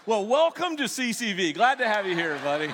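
loud, high, hammed-up nervous cheer; nervous welcome. To the trained assassin, a loud mock-nervous welcome. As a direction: overdo the welcome to hide the fear.

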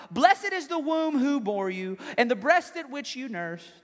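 high, slow, drawn-out call; acted cry from a listener in the crowd; woman yelling out. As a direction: call it out slowly over a crowd. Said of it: "a woman yells out".

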